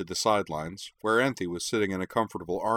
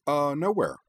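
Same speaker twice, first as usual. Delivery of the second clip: quick, high flustered dodge; hasty; 8 semitones higher than usual. A narrator's reading. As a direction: blurt it out to cover. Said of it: "said Utena hastily".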